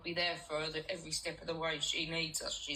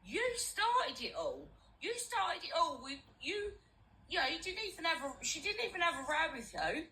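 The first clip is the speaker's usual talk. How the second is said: mocking impression; 9 semitones higher than usual. Mocking an accuser in a quarrel.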